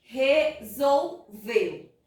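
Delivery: "Resolveu" slowly as re, sol, veu; short gaps between each syllable of "Resolveu". Shown as a demonstration. Teacher repeating the finished division, slow and segmented. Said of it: "divisão silábica realizada".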